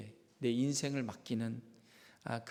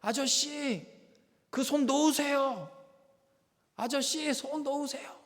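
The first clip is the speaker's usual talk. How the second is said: much higher, slow firefighter calling out from below; firefighter shouting; 12 semitones higher, 3 dB louder, slower than usual. Retold or imitated. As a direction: call up to the man to let go. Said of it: "큰 소리로 외치는 거예요".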